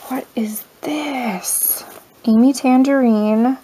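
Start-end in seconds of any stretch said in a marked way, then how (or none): 0.1-1.8 slow breathy whisper of wonder; awed whisper. Real, not acted. Finding a gift in the box, whispered in awe. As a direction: whisper it as if it's precious.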